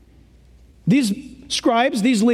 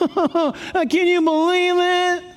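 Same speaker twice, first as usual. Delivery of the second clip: whining; 5 semitones higher than usual. Acted. Pharisees complaining to each other about Jesus receiving sinners, whining. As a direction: whine it out in scandalized disbelief. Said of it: "in their whining, they're like this".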